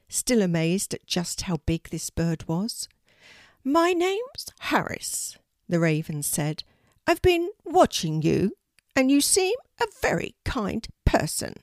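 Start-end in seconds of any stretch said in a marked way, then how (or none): 3.7-5.4 high, slow bird voice; a big talking raven, high and odd; unusual raven voice. A narrator's reading. Raven Harris speaks. 7.1-11.5 a big talking raven, high and odd; unusual raven voice. A narrator's reading. Raven Harris speaks.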